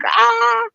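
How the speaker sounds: sudden high open-throated ah; released cry. Retold or imitated. Telling friends how grief burst out, a high released ah.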